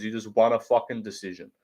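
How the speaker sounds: usual speaking voice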